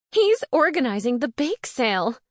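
high, loud, outraged wail; frantic indignation, loud and high; near hysterics. A narrator's reading. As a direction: pitch it up as if close to losing it. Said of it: "near hysterics".

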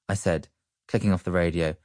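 ordinary delivery

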